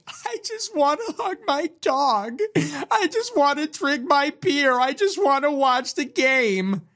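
high, weepy whine mocking a loser; mock crying whine. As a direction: whimper it like a sad sack. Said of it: "sounding like he's gonna cry".

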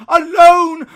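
alone cried high and loud; anguished cry. Acted. Scrooge dreading dying alone, anguished cry. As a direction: wail the word alone in anguish.

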